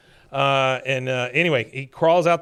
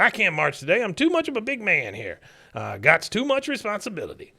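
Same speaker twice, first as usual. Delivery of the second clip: put-on grumpy old man voice; 7 semitones higher than usual. Father refusing to march.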